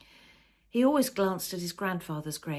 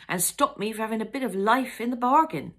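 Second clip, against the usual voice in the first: louder, bitter wife; bitter retort; 5 semitones higher, 8 dB louder than usual. A narrator's reading. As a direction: throw it back at him. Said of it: "Jan threw back".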